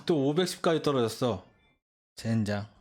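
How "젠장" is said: low muttered curse; cursing under breath. Real, not acted. Rating drops after a loss.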